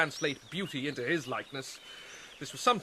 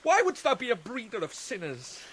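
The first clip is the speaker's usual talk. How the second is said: higher, agitated self-accusation; bitter outburst; 8 semitones higher than usual. Acted. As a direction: lash out in bitter disgust at yourself. Hamlet rails at Ophelia.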